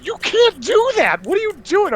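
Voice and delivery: high, loud mock outrage; imitated scandalized bystanders; shocked onlookers. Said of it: "people were just like".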